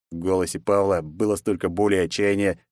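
ordinary delivery

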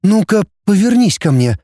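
high-pitched, tense command. A narrator's reading. Girl orders Рома to face her, tense. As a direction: order him round, lightly strained. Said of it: "сказала Мальцева напряжённым голосом".

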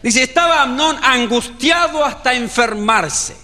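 ordinary talk

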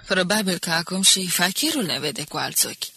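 plain reading voice

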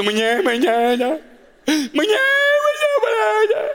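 hammed-up blubbering wail; mock wailing. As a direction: sob out the words like a baby, pitch rising each time. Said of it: "Ich so".

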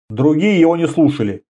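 usual speaking voice

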